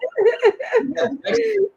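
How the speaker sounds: a sudden high burst of laughter. Real, not acted. Host teased about pronouncing name.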